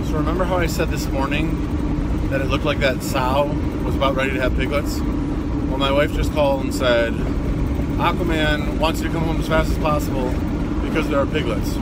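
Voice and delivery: loud, eager news of new piglets; excited. Real, not acted. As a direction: burst with excitement, talk loud. Rushing home excited, piglets born.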